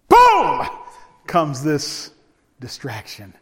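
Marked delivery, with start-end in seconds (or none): 0.1-0.7 very high, loud shout of boom; preacher's sudden shout. Acted. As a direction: explode the word like a blast. Sudden distraction arrives.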